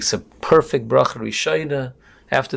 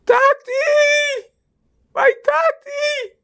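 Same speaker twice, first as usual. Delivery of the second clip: very high, loud, sobbing scream; screamed cry; 5 dB louder, much slower than usual. Retold or imitated. Gabbai recognizes his own father, screaming out in tears. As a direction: cry it out long and tearful, acting the long-lost son. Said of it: "he screamed out".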